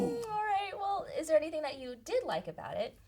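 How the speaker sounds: high, small, taken-aback voice; meek reply. Retold or imitated. Author recounting her answer to her agent, meek and high. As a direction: squeak out a cautious question.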